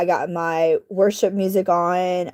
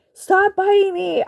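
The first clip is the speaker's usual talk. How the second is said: pained plea; 10 semitones higher than usual. Retold or imitated. Kitten biting her.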